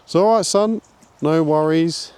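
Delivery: a touch of hammed-up fatherly voice; playing indulgent dad. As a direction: answer like a lenient dad. Owner replies as indulgent dad.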